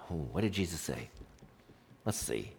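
low, very soft pondering; acted-out hushed self-questioning; musing to himself. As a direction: think aloud under your breath.

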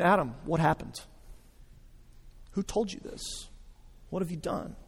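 soft, hushed questions that invite confession; gentle coaxing. Acted. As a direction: coax him out of hiding, tenderly. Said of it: "coaxing them out of their shame".

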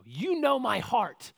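slow, high, drawn-out "You know my heart"; repentant appeal. Acted. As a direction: lift and stretch "You know", pleading. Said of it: "what does Peter's repentant heart say?".

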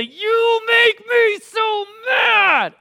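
high, slow, full-volume mock tantrum shout; drawn-out screamed tantrum, imitating a small child; child's temper tantrum. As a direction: yell it like a furious five-year-old, dragging every word. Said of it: "temper tantrum".

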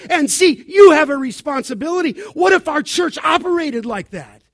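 loud, high, urgent exhortation; forceful preaching. Real, not acted. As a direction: preach it at full force to the congregation.

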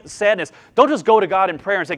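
ordinary delivery

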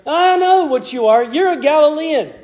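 high, pointed accusing voice; mocking accuser. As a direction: jab at Peter like a suspicious bystander. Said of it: "He's like".

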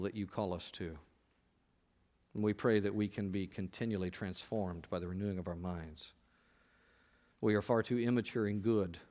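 low, soft praying voice; prayer. Real, not acted. Preacher leading the church in confession to God, soft prayer. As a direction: pray humbly, low and hushed, with long pauses.

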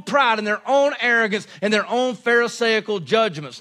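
emphatic, driving sermon delivery; forceful preaching. Real, not acted. Preacher denouncing false witnesses to the congregation, forceful preaching. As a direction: hammer the key words home to the congregation.

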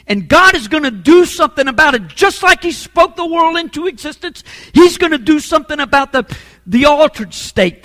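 loud, high, urgent sermon burst; impassioned preaching. Real, not acted. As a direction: surge into a forceful promise.

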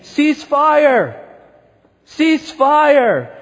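high, loud shout to cease fire; yelled command. Acted. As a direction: yell it as a general halting troops in battle. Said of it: "he was yelling".

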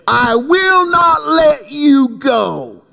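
high, slow, stretched-out vow; resolute declaration. Acted. Jacob refusing to release the angel, resolute declaration. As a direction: declare it slowly, every word held high.

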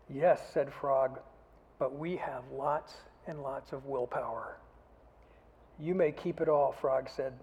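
put-on animated children's story reading. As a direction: read the story with big character voices for the kids.

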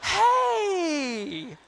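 high, sugary phone greeting; sweet Southern belle. Retold or imitated. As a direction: switch instantly to syrupy charm. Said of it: "she sounds like a sweet Southern belle".

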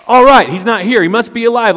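high, loud, bright mock cheer; hammed-up upbeat discovery, like it's no big deal; loud, high pretend cheerful realization; mock cheerful relief. Mock cheerful reaction ladies didn't have.

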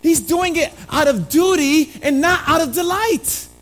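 full-force preaching. Acted. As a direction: drive the point home from the pulpit.

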